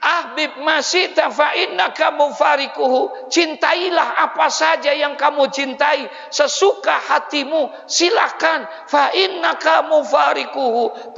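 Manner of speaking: high, loud sermon proclamation; full-force preaching. Acted. Preacher proclaiming the hadith to the crowd. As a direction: proclaim it to the congregation.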